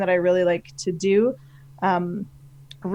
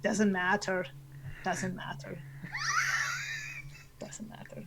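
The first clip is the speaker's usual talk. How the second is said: each repeat softer, down to a faint breath; fading repetition; 11 dB quieter than usual. Acted. Finishing the team answer in character, fading away. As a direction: let it die away comically.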